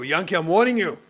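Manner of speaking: very loud, high threatening shout; furious warning. Retold or imitated. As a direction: escalate into a full-on yell of warning. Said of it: "You walk over".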